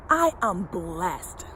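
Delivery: low, soft and slow; affirmation read. Acted. Coach reads today's affirmation to viewers, low, soft and slow. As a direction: let the affirmation sink in gently.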